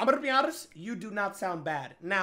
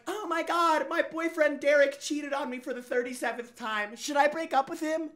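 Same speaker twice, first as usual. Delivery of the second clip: higher, whiny mocking girlfriend voice; mock girl voice; 6 semitones higher than usual. Host mocks girls who say they like nice guys, in a higher whiny voice. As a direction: parody a girl crying about her cheating boyfriend. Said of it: "But then they're like".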